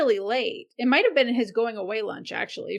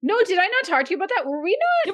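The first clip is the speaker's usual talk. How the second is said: excited; 9 semitones higher, 4 dB louder, faster than usual. Real, not acted. Excited insistence.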